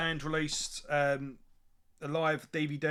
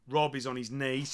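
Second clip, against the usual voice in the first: ordinary talk, nothing marked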